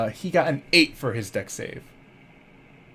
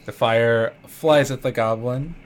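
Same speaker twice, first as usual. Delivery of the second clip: usual speaking voice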